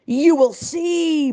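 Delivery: slow, high, loud boom of a furious governor; a governor booming his threat. A narrator's reading. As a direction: boom it out slowly like a tyrant. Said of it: "boomed the governor".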